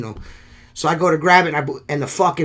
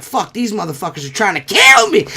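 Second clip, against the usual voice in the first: louder, higher alarmed outcry; mock panic; 5 semitones higher, 5 dB louder than usual. Man retelling a wasp attack, mock panic. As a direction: play up the panic of being attacked.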